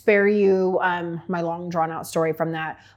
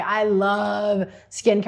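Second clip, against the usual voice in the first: drawn out; much slower than usual. Real, not acted. Gushing confession after laugh.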